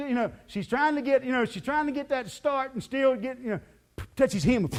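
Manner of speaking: ordinary talk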